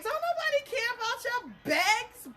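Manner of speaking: high, loud retold dismissal; mock scolding. Teller imitating Baba brushing off begging, high mock scorn. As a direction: throw it back high and incredulous, imitating.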